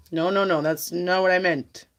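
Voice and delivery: ordinary talk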